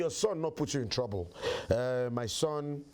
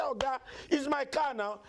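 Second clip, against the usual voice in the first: high-pitched exasperated protest; indignant protest; 12 semitones higher than usual. Acted. Comedian retelling his protest to a car thief, indignant. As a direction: protest ownership in a much higher pitch.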